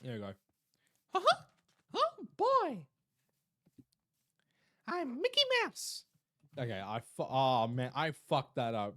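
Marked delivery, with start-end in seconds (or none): 1.1-6.0 very high cartoon falsetto; Mickey Mouse. Shown as a demonstration. Streamer retrying the impression after water, cartoon mouse voice. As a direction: squeak it brightly as a cartoon mouse. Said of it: "pretty decent Mickey Mouse impression".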